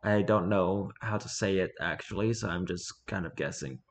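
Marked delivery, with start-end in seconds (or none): none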